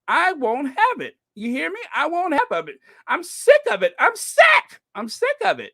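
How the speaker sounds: hammed-up high-pitched indignation; mock outrage. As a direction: build to a squealed mock tantrum, then ease slightly. Hammed-up crusade against airbrushing.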